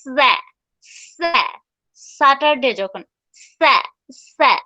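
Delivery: "স্যা" clipped and repeated, lips spread; syllable drill. Shown as a demonstration. Teacher drilling the first syllable of Saturday. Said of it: "এখানে কি বলবো?".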